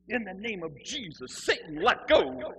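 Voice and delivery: loud, drawn-out mock exorcism command; zealous exorcist. Zealot shaking him by the throat, loud exorcising command. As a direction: build to a big slow let go.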